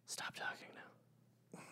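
slow, very soft whisper; hushed, drawn-out warning; whispered rebuke. Real, not acted. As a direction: whisper it slowly to shut them up. Mock rebuke after bad pun argument.

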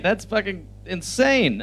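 normal talking voice

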